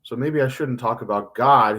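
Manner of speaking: ordinary talk, nothing marked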